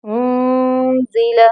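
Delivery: उनजिला with the noon lightly hidden, slow. Shown as a demonstration. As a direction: stretch उनजिला and barely sound the n. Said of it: "हल्का सा नून को छुपा लें".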